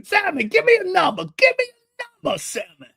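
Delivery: put-on high, pleading old-man voice; insistent older man. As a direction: pester him eagerly in falsetto. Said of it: "It's how he talks".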